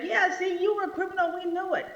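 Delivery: high, gloating told-you-so; smug government. Retold or imitated. Preacher voices the government gloating over Jesus, high and smug. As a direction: sneer we knew it. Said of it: "The government is like".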